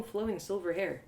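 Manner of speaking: usual speaking voice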